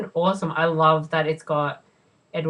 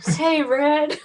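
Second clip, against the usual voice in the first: higher called-out line as Andy; lightly voiced movie line, pitched up; mock Tim Robbins call; 9 semitones higher than usual. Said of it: "Come on, Red".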